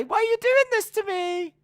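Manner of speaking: loud, very high squealed plea; Mandy Rose impression. Reply to a bully, loud squealed mock plea. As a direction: wail it in a mock falsetto. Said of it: "just Mandy Rose on the floor going".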